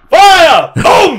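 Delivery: high, loud mock cannon command; imitated shout of a gunner; distant battle cry. Retelling cannons heard from the market, loud shouted command. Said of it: "you hear in the distance".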